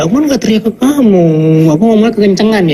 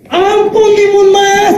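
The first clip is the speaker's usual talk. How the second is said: much higher, hammed-up plea for mercy; mock pleading; 11 semitones higher, much slower than usual. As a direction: beg like a frightened giant. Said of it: "Oh, Didi ketakutan".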